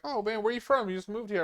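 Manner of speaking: high-pitched chatty Southern customer. Retold or imitated. Southern parts customer to the newcomer at the truck shop, chatty. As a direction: imitate a friendly stranger asking. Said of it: "like, Southern cats from".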